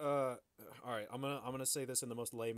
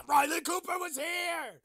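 hotel keepers yell it out, slow and high; hammed-up angry shout; mock outraged shout; 9 dB louder than usual. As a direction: bellow the name in mock outrage. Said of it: "they're like".

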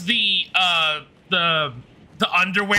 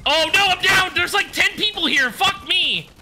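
panicked yelling; 9 semitones higher than usual. Real, not acted. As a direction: yell it out in panic, voice up high. Downed by ten players.

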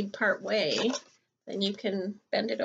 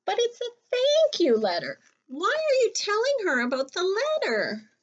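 much higher, louder puzzled rabbit voice; 12 semitones higher, 5 dB louder than usual. Acted. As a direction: squeak out Rabbit's confusion. Rabbit voiced in a read-aloud.

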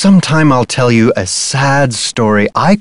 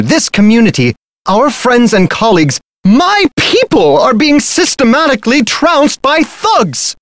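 building, higher and higher pitch; rising alarm; 9 semitones higher than usual. Acted. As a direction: work yourself into a panic. Rising alarm over thefts.

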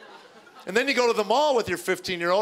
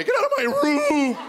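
put-on squeaking, much higher and louder teen yell; cracking yell; 4 dB louder than usual. Son yells at brothers, voice cracking high. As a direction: shriek it as the voice breaks upward. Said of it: "He had that crackling thing".

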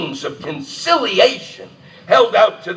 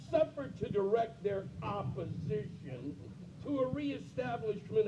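reading the declaration much softer, held down; hushed reading; 2 semitones higher, 22 dB quieter than usual. Acted. Reader calming down mid-declaration, reading it much softer. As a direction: read it on, softer, after telling yourself to calm down.

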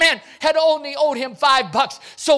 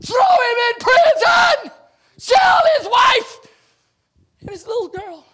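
furious order; 12 semitones higher, 6 dB louder than usual. Acted. The servant orders the debtor thrown in prison, shouting high. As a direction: hurl the order at the guards.

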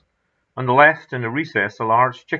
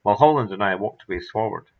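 ordinary delivery, nothing marked

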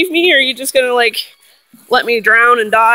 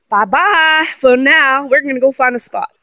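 normal talking voice

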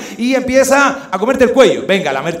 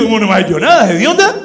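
outraged retort; 5 dB louder than usual. Retold or imitated. The frustrated man yelling back at the girl, outraged. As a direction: snap back in disbelief. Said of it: "retort to her".

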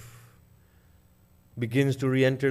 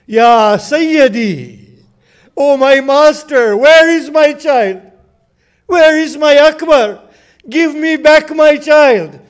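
high, loud, slow pleading wail; mother's anguished cry; 11 semitones higher, 12 dB louder than usual. Acted. Layla begging Hussein for her son, anguished high wail. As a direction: cry out for your lost son.

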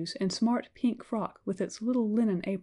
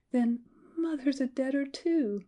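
higher, mild mock sob of a little girl; crying child; 6 semitones higher than usual. Girl learns her mother died, a touch of crying. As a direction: break down over mother, understated. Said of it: "cried the little girl ... sobbing bitterly".

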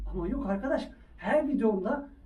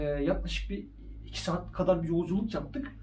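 ordinary talk, nothing marked

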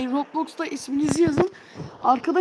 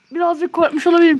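1 rushed loud admission; 3 semitones higher, 8 dB louder, faster than usual. Real, not acted. Admits fear right after scream.

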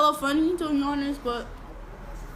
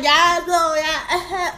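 lightly exaggerated, louder imitation; 3 semitones higher, 6 dB louder, much slower than usual. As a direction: punch it out as a mocking impression.

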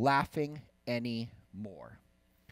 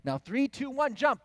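rising, higher and louder to jump; excited countdown; 12 semitones higher, 5 dB louder than usual. Retold or imitated. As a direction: count down like cheering kids.

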